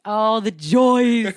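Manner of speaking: loud, high, long-drawn exclamation; mock rapture. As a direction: draw it out grandly in ironic delight. Mock celebration of grim filming job.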